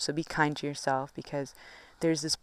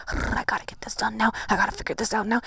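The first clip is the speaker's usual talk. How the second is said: frantic inner voice; 4 semitones higher, faster than usual. Retold or imitated. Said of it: "But you're like".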